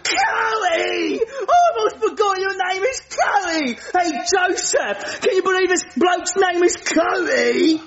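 gleeful British laughing cry; delighted laughing shout. Acted. Nigel remembers Cody's name.

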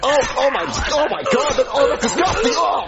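high, laughing disgusted cries; horrified. Acted. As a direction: recoil from the gore, squealing. Watching gory killing on screen.

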